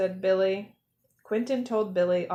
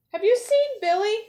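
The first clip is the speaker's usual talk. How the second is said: high, loud worried question; a frantic mother asking everyone; anxious plea; 11 semitones higher, 4 dB louder, faster than usual. A narrator's reading. Billy's mom asks the penguins, anxious and high.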